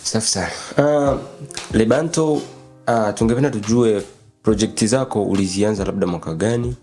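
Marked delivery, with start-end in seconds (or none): none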